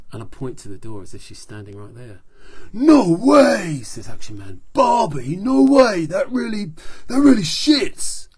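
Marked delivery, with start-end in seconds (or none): 2.7-3.8 loud, high, beefy hero voice; big friendly praise from a tough guy; hearty action hero. Acted. Action Man cheering up Doctor Z, hearty and loud. 4.7-6.7 loud, high, beefy hero voice; big friendly praise from a tough guy; hearty action hero. Acted. Action Man cheering up Doctor Z, hearty and loud. 7.1-8.3 loud, high and slowed hero voice; big voice. Acted. Action Man reacting to the breakup, loud and hearty.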